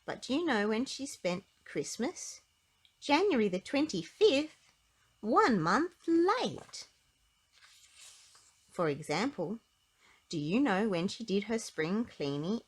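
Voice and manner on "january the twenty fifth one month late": comic punchline. A narrator's reading. Comic punchline of late dates.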